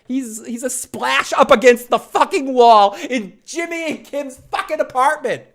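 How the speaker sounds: high, loud rant; outraged outburst. Real, not acted. Fan ranting over a character's death, high outraged yelling. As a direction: erupt in a high-pitched shout.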